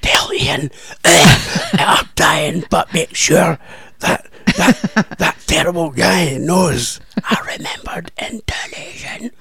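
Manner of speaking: hammed-up deathbed voice, whispered then high and strained; mock dying last words. [name] giving joke last words to her co-host, hammed-up dying voice. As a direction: play a dying woman gasping out her final wishes. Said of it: "before you leave this mortal coil".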